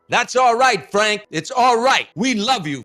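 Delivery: high, loud call from far up in the stands; hollered from the crowd. Retold or imitated. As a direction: yell it out to Frank across the arena. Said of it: "he hollered out".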